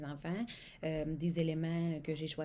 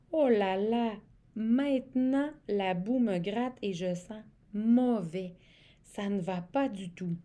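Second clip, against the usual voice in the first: upset wolf; 4 semitones higher, 6 dB louder than usual. Acted. Wolf's complaint read aloud.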